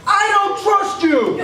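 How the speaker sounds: high, slow furious shout; pissed-off yell. Retold or imitated. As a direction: scream it at the priest. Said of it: "really freaking pissed off".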